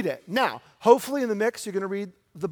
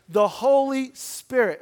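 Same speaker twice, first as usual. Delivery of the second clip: slow, a bad British accent, louder and higher; terrible British accent; 5 semitones higher, 6 dB louder, slower than usual. Preacher's quick jab at a British reading, a terrible British accent. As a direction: lean into a hammy British accent. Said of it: "it was a terrible British accent".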